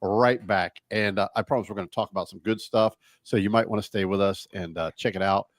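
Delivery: upbeat announcer read into the break; radio host break announcement. Acted. Host announcing a break to listeners, announcer read.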